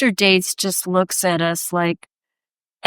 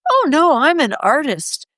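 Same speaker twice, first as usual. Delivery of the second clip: higher, emphatic mock indignation; indignant protest; 5 semitones higher than usual. Artist retelling her protest to the doorman, indignant. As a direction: protest grandly, pitched up.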